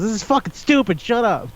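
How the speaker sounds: mildly indignant retold snap; mock exasperation. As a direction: imitate someone abruptly fed up. Acted outburst after sentimental moment.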